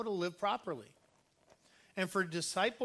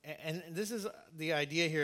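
ordinary talk

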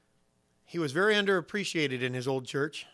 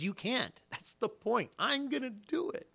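high, softer, rising emphasis; acted answer, pitched up and insistent; God's emphatic answer; 8 semitones higher, 6 dB quieter, slower than usual. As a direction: climb higher with each short sentence. God answering Moses' doubt, acted.

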